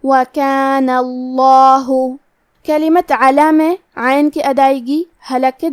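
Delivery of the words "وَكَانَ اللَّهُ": اللَّهُ chanted slowly with a full, heavy لام; heavy lam. Shown as a demonstration. Teacher demonstrating tafkheem to students, heavy لام in اللَّهُ. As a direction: give the لام of اللَّهُ a thick, heavy sound, slow. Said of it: "بھاری آواز میں پڑھنا ہے".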